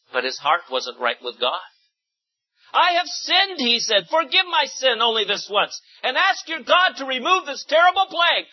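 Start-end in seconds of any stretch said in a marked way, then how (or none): 2.7-8.5 begging. A narrator's reading. Pharaoh begging forgiveness.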